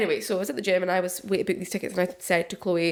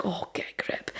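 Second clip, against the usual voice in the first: muttered dismissal; 7 dB quieter than usual. Retold or imitated. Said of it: "And I'd be like".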